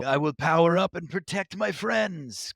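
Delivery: hammed-up shonen hero vow; anime hero voice. Mock anime hero line.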